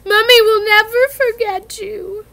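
weeping parting words; tearful. A narrator's reading. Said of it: "Hagrid sobbed".

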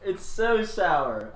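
laughing, slow, through a sour bite. Real, not acted. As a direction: giggle out the words slowly. Laughing while eating sour bean.

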